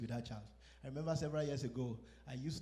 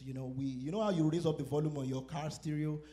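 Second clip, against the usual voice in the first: ordinary talk, nothing marked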